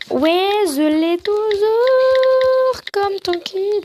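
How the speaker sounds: put-on high, babyish, very drawn-out vowels; silly put-on voice. Joking about still having the gifted mug, high silly voice. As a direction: stretch it out in a high silly lisp. Silly redo, then stops herself.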